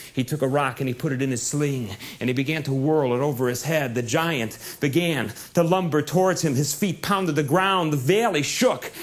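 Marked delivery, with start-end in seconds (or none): none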